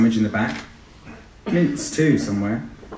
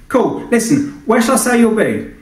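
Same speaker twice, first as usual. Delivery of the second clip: much higher and louder brash client shouting; shouty client voice; 11 semitones higher, 6 dB louder than usual. Acted. Client Sinatra shouts questions at the pilot, brash and pushy. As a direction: yell through the intercom, pushy. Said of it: "A shouter too".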